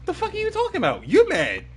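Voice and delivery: high, incredulous denial; defensive squeal. Real, not acted. Denying to chat that he is mad, in a high squeal. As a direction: squeak it in disbelief.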